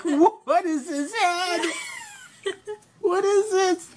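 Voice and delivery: high, laughing till near tears. Real, not acted. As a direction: crack up while asking. Laughing hard at drawing.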